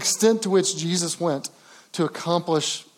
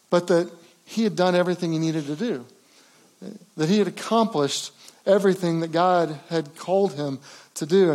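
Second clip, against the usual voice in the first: ordinary delivery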